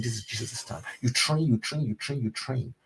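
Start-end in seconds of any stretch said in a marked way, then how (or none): 0.0-0.8 softer, inward reminder to himself; calm yourself back to focus; quiet self-reminder. Retold or imitated. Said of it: "I tell myself again".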